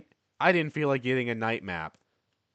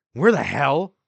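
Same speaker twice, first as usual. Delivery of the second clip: startled yell; 4 semitones higher, 11 dB louder, slower than usual. Acted. Voice caught through closing portal.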